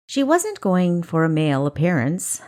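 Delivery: ordinary delivery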